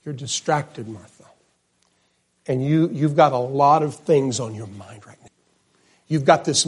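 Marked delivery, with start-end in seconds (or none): 0.0-1.2 quiet tender rebuke. Retold or imitated. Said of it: "he says".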